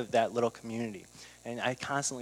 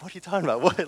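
retold disbelief, a touch higher with a laugh; laughing in disbelief at his friend's trick; incredulous; 4 semitones higher, 4 dB louder, much faster than usual. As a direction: act baffled that the bill is paid. Learning the bill was paid.

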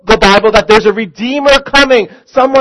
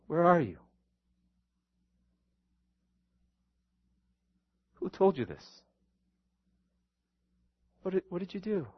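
very soft, gentle questions; tender God; 16 dB quieter than usual. Retold or imitated. Preacher voicing a gentle God, very soft. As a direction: ask it softly like a loving father. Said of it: "And He's saying".

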